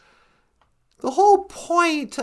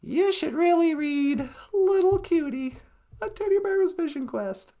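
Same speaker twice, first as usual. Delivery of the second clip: ordinary talk, nothing marked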